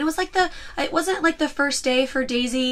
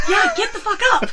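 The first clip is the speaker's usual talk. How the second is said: laughing retort; 10 semitones higher, 3 dB louder than usual. Retold or imitated.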